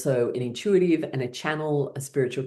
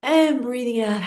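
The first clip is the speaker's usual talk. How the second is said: bright breath cue; 10 semitones higher than usual. Shown as a demonstration. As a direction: lift the voice on the out-breath. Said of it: "one more big breath in".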